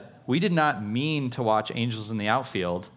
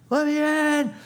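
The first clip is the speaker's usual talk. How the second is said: much higher, pleading at the door; urgent Peter; 4 dB louder than usual. Retold or imitated. Peter knocking at the house, high urgent plea. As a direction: beg to be let in, pitched up high. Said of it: "he's like".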